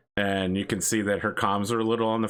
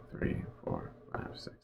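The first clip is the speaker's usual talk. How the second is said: counting under the breath, whispered; 12 dB quieter than usual. Real, not acted. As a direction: mutter the count to yourself.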